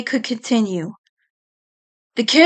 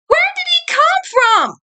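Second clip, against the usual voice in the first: scream. Acted. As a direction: shriek it in alarm. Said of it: "Eddie screamed".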